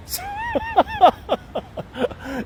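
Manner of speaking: very high-pitched, drawn-out giggle; high laugh. Real, not acted. Bajan warrior tickled by his own boast, high giggling laugh. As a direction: crack up in a high squeal.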